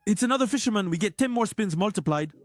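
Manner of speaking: ordinary delivery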